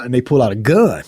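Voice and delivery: ordinary delivery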